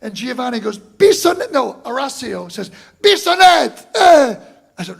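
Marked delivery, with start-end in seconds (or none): 0.8-1.5 shouted invitation. Retold or imitated. Bandmate invites him to sit in. 3.0-4.4 shouted invitation. Retold or imitated. Bandmate insists he sits in.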